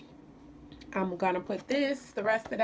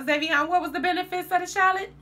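calling out; 8 semitones higher, 4 dB louder, faster than usual. Real, not acted. Mother calling her son over to explain, loud and quick. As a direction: raise the voice to call Zavion over.

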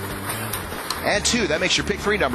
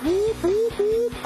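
high-pitched whoop; much slower than usual. Acted. Playful sound during ball draw.

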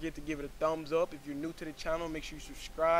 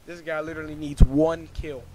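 loud frustrated outburst; 12 dB louder than usual. Retold or imitated. Retold frustration during close match.

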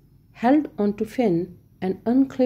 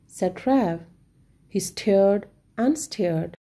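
usual speaking voice

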